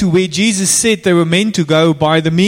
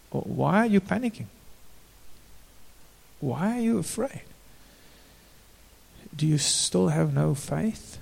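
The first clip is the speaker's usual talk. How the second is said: softer, calm questioning; gentle Jesus; 10 dB quieter than usual. Retold or imitated. Jesus gently asking his frightened disciples, softly. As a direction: ask it gently, almost hushed.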